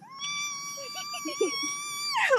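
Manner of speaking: long, very high squeal through clamped teeth; imitated tantrum squeal. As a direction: squeal like a furious little kid, mimicked. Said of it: "you clamped your teeth shut".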